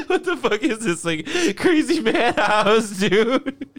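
high, loud, laughing through the words; cracking up while asking what is going on; giggling incredulous rant; laughing disbelief. Retold or imitated. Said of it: "I'm like".

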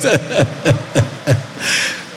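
rapid, chuckling laughter; amused laugh. Real, not acted. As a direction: laugh along after teasing a friend. Laughs after teasing the visitor.

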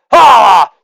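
sharp loud barked "Ha!"; shouted ha. Acted. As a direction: punctuate the bit with a shouted ha. Comedian's signature shout.

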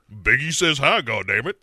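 a hint of a gruff old man; menacing old-man quip, lightly done. [name] taunting over [name], lightly voiced old man. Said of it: "it was [name] that killed them all along".

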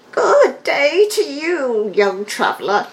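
high, slow crone greeting; an old hag drawing out her greeting; high slow witchy voice; old beggar woman. A narrator's reading. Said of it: "said the beggar woman".

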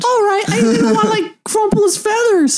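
very high, laughing voice; character voice. Acted. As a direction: keep the high squeak going.

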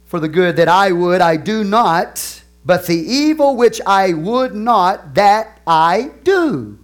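loud, slow, drawn-out pulpit reading; weighty preacher reading aloud; emphatic scripture reading. Real, not acted. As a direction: proclaim the verse, stretching out the words. Reads scripture aloud with force.

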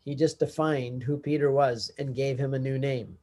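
ordinary talk

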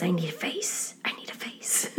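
whispered, breathless panic under the breath; Mrs. Doubtfire's muffled whisper. Retold or imitated. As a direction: whisper it urgently, voiceless. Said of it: "she says".

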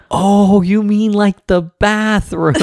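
high, drawn-out "oh" of discovery; dawning realization. Retold or imitated. Dad voicing daughter who finally gets it, exaggerated realization. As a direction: imitate a kid finally getting the joke, loud then easing. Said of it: "she finally gets it".

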